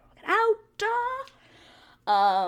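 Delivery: high, loud pleading cry; suddenly loud, high exclamation; mock outburst. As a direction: burst out in mock desperation. Plea builds to an outburst.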